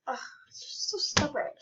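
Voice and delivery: whispered grumble. Acted. Maid grumbling about the child, whisper. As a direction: mutter it under your breath.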